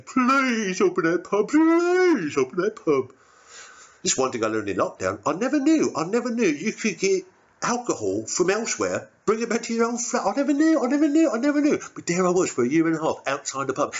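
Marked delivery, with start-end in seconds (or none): 0.0-3.1 pleading. Acted. Begging at the pub door. 5.2-11.8 amazed. Acted. Comic character marvelling.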